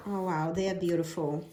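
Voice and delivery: normal talking voice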